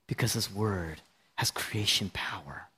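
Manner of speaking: low, hushed whisper. Acted. Preacher whispering to the congregation about creation power. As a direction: drop to a secret whisper.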